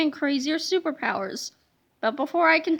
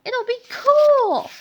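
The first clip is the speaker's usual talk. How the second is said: put-on slow boyish; 7 semitones higher, 5 dB louder, slower than usual. Harold voiced.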